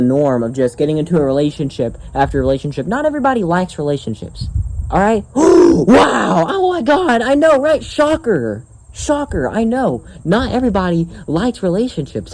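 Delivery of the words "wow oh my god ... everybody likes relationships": high, sarcastic fake shock; mock astonishment. Vlogger mocking viewers' shock at his opinion, mock astonishment. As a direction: gasp and play it wildly overamazed.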